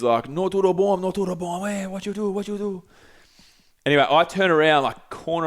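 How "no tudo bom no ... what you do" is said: higher, mock Portuguese accent, scolding; imitating a Brazilian bouncer telling off a colleague in a Portuguese accent; Portuguese yelling. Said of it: "starts yelling in Portuguese".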